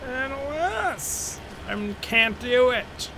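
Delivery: high, slow, hammed-up woe; mock despair at his own tongue-tied talking; mock tragic lament. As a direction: sigh it out like a tragic hero. Mock lament about failing to talk.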